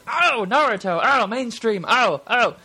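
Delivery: high, loud mocking scoff. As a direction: sneer at it as too mainstream. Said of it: "you guys probably go".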